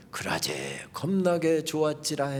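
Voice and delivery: slow, soft Jeolla saying; Jeolla dialect quote. Retold or imitated. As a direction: savour each word in Jeolla dialect. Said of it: "마지막 문장을 택하라고 한다면".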